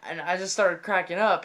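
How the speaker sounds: ordinary delivery, nothing marked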